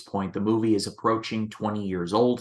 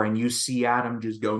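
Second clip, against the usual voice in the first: ordinary talk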